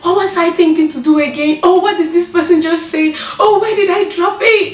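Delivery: a touch of mock panic in her head; flustered self-quote. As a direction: replay the panic of forgetting. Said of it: "I'm like".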